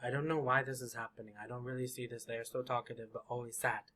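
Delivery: low, soft, downcast rambling; talkative and sad. Acted. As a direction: mumble on sadly, low and quiet. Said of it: "They could be talkative and sad".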